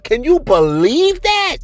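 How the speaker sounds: high, slow incredulous question; can't believe what he's reading; shocked disbelief. Real, not acted. Reading snitch salaries to viewers, high stunned disbelief.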